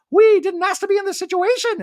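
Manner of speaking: very high, loud, squeaky voice; Dorit impression. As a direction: squeak it like a posh socialite.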